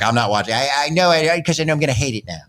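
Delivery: lightly imitated friend's reluctant refusal; a hint of your friend's way of talking; Corey. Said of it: "That's how he talks".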